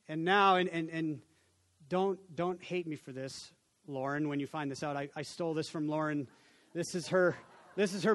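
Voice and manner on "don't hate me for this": ordinary delivery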